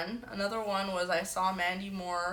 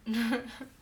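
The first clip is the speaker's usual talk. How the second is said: short high giggle; real chuckle; 4 semitones higher than usual. Real, not acted. Streamer amused by chat guessing mom's accent, short giggle. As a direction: let out a brief laugh.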